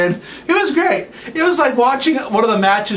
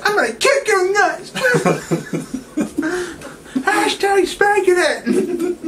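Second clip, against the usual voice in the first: much higher, put-on cartoon voice; silly squeaky tease; 12 semitones higher, slower than usual. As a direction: tease him in a goofy high voice. Teasing co-host in silly voice.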